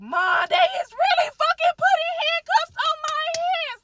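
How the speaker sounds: re-enacted high-pitched, full-force scream. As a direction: scream it desperately to your mother. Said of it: "Like, I was screaming".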